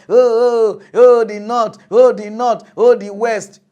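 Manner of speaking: loud mocking wail of complainers; mock shout. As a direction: shout it like a whining crowd.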